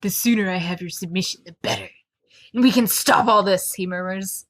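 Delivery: a hint of a menacing murmur. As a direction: read him as a controlling lover. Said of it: "I will read them".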